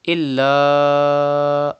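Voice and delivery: إلا held out for five counts; drawn-out madd on إلا; long madd. Shown as a demonstration. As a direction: stretch the alif of إلا long and slow. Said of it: "stretch up to five harkat like this".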